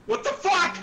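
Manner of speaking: high shocked yelp; shocked shriek. Real, not acted. Sudden scare.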